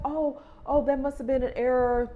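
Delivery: lightly voiced apologetic agent; imitating the agent. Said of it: "She's like".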